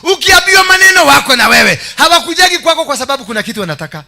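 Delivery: high, loud, driving proclamation; full-force preaching. Real, not acted. Preacher describing loyal burden bearers who stand by you, shouting high. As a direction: shout each phrase out to the congregation.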